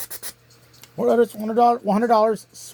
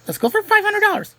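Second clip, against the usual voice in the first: fast, much higher, eager rush; eager bet; 11 semitones higher, much faster than usual. Real, not acted. Contestant bets big, eager.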